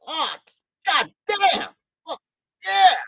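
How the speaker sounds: high, hammed-up cry of glee at a bargain; mock excited outburst. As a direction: act out gleeful swearing over a cheap book. Acted self-quote, excited buy.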